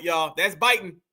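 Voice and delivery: ordinary talk, nothing marked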